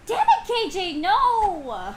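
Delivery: high loud playful protest; excited yelp. Real, not acted. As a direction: yelp it out laughing. Laughing protest at friend.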